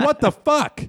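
high-pitched, incredulous protest; aggrieved comic disbelief; indignant outburst. Retold or imitated. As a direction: throw it up high, outraged. Mock outrage at news of police.

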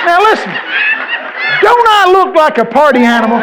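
high, loud come-on; party girl. Acted. Party girl accosting the preacher in an elevator, loud high squeal. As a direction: belt it out like a party girl hollering. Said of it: "one of 'em says to me".

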